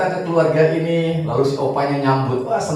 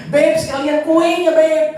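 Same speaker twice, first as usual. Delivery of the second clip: much higher, playful pet-name call; grandpa's sweet call; 12 semitones higher, 4 dB louder than usual. Retold or imitated. Grandpa asks babe for cake too, much higher and playful. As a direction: coo it across the house.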